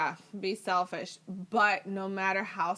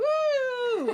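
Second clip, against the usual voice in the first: high-pitched carefree "woo" cheer; long, soaring woo, playfully imitated; mock party whoop; 4 dB louder than usual. Mimics carefree drunk whooping.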